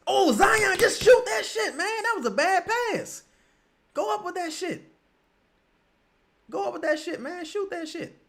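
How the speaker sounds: real exasperated yelling; frustrated yelling. Real, not acted. Yelling at player on TV.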